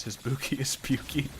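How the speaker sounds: goofy punchline delivery; milk the bad pun; cheesy pun. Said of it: "That was bad".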